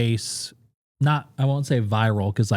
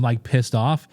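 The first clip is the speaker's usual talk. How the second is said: ordinary talk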